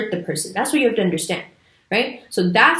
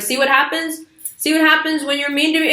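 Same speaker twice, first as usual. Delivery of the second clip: higher, faster mock-mother voice; imitating scolding mom; 7 semitones higher than usual. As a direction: play the told-you-so mom, pitched up. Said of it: "your mom will be like".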